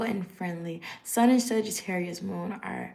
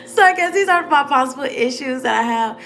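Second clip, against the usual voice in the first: laughing, higher and louder; 5 semitones higher, 6 dB louder than usual. Real, not acted. Reading her possible issues, laughing through the words.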